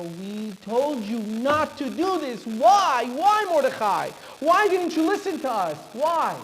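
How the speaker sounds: acted despairing protest; anguished reproach.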